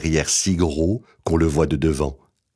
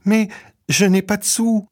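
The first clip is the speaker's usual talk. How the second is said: put-on high sad child voice; a hungry little boy with no money; small boy voice. Petit bonhomme has no money.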